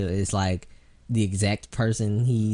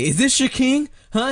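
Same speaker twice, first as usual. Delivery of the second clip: much higher, louder mock Killmonger challenge; hammed-up villain taunt, pitched way up; imitating Michael B Jordan; 12 semitones higher, 3 dB louder than usual. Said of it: "Michael B's like".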